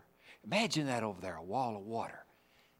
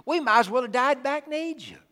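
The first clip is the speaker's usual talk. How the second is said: complaining Israelites; 10 semitones higher, 6 dB louder than usual. Retold or imitated. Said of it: "What did they say?".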